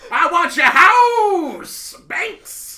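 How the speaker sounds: high, slow, laughing rock-star growl. Pretend.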